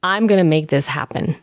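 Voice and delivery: usual speaking voice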